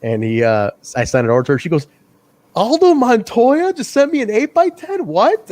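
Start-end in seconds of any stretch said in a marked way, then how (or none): 2.5-5.5 high, loud mock astonishment; imitating an incredulous, excited reaction; astonished disbelief. Sister amazed by an eight-by-ten from a wrestler, astonished.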